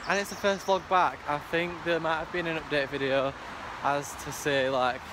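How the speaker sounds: ordinary delivery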